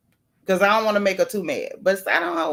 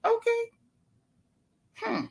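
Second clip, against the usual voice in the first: a stunned, much higher "okay" that fades soft; taken aback; 12 semitones higher, 6 dB quieter than usual. Retold or imitated. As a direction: caught off guard, then go quiet. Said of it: "I said".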